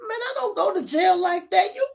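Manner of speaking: high loud panicked yelp; scared of going to jail. Retold or imitated. Seeing the police lights swing the corner, panicked high cry.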